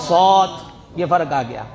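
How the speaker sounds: ordinary delivery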